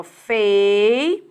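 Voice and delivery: "Fei-u-ra" spaced out syllable by syllable; split into syllables. Shown as a demonstration. Teacher analysing the word aloud, syllable by syllable. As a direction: tap out "Fei-u-ra" in three beats. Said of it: "Vamos analisar essa palavrinha aqui".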